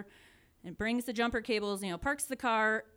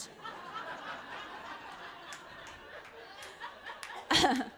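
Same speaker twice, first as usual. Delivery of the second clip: breathy laugh; 10 semitones higher, 5 dB quieter than usual. Real, not acted. Laughing at own story.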